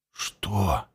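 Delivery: low, soft hoarse reply. Acted. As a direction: answer hoarsely from weakness. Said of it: "хрипло отозвался тот".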